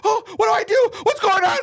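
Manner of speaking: very high, loud hammed-up panic; panicking. Girlfriend not helping the injured rider, shrieking panic. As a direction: imitate a shrieking, helpless panic. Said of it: "Like, she's panicking".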